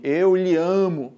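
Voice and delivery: slow, each word set apart as a common error; slow wrong example. Shown as a demonstration. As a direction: say it slowly, a mistake to show. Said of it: "As pessoas dizem".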